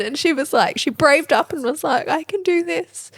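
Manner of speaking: fighting back happy tears while telling it; teary proud mum. Real, not acted. Mum chokes up telling of daughter's first school day, teary and high. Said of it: "You feel all the emotions?".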